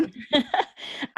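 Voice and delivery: short breathy laugh of relief; breathy chuckle of relief; light laugh. Real, not acted. Laughs at doing it right.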